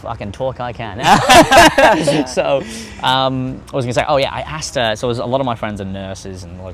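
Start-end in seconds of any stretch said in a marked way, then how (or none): 1.0-2.2 high, loud burst of laughter. Real, not acted. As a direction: burst out laughing at your own joke. Joking about never shutting up.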